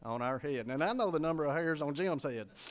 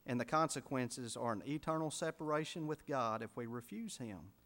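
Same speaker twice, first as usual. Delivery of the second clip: ordinary talk, nothing marked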